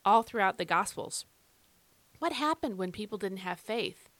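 ordinary talk

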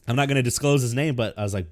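ordinary delivery

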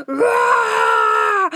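low, loud growling roar; a haunted-ride monster's loud low "Rah!". Retold or imitated. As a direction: jump out with a deep roar. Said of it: "they're like".

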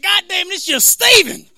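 high, loud mock exasperation; Klansman caricature. Klansmen spotting Steven, caricatured loud letdown. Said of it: "they see me and they're like".

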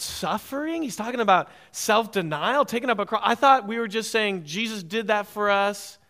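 mock the objection with disbelief; imitating a startled listener. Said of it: "completely new concept for you to hear".

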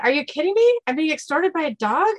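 high-pitched comic indignation; incredulous. Acted. Dean to the extorting Yorkie, incredulous.

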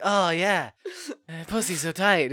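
hammed-up loud porn-star groan; porn actor parody. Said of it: "the dude's like".

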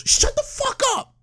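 high-pitched mock scream of outrage; retold yell. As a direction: snap it out in a high, squealed yell. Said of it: "dead ass yelled".

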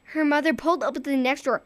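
ordinary delivery, nothing marked

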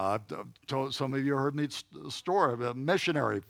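usual speaking voice

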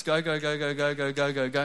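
a busy day's rush, fast at the end; breathless list of go's. Shown as a demonstration. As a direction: rattle off the go's, speeding up. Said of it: "I've just got no time".

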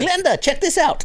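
mock call-out. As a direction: call across the yard like a lady from Delaware.

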